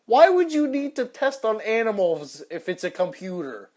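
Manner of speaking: high, loud disbelief at a silly question; incredulous outburst. Real, not acted. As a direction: scoff loudly at the absurd question. Mocking an absurd search question.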